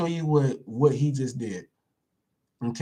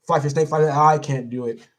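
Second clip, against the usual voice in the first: usual speaking voice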